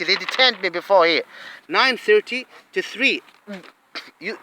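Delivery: normal talking voice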